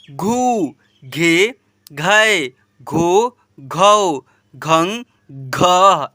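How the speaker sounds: syllable recitation. Shown as a demonstration. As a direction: chant the syllables one by one like a drill. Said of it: "ऐसे पढ़ेंगे". (लय में एक-एक अक्षर पढ़ना)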